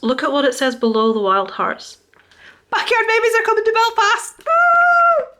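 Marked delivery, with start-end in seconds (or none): 2.7-4.4 high, bursting with excitement; squealing with real excitement; thrilled announcement. Real, not acted. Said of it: "I am so excited". 4.4-5.3 high, loud whoop of joy; real cheering woo; excited whoop. Real, not acted. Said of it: "I am so excited".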